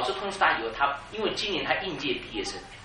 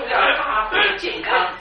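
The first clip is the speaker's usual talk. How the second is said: higher, louder, slow laughing cry; laughing exclamation; 8 semitones higher, 10 dB louder, much slower than usual. Real, not acted. Pastor laughing about a parent's impossible task. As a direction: burst out laughing then exclaim.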